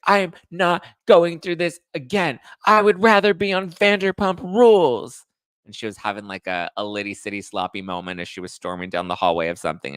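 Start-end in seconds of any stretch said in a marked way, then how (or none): 0.1-5.3 mock yell. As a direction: storm off yelling like Kristen in the hallway. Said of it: "She's like".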